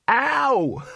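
high yelp then laugh; a high yelp of pain then a laugh; pained cry. A narrator's reading. Victim cries again, laughs.

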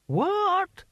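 very high, rising one-word squawk. Acted. Mad Hamish fails to catch the answer, high querulous query. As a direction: pitch the question right up.

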